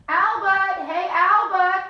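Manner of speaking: high, loud calling out; yelling. Acted. A boy yelling up at his friend's window. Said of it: "I yelled at his window".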